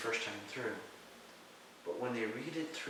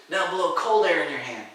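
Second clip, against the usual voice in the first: loud retold order, raised pitch; commanding; 5 semitones higher, 11 dB louder than usual. Teacher directing his student's breath, loud retold command. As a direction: give the next instruction firmly.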